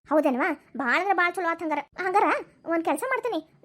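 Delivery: normal talking voice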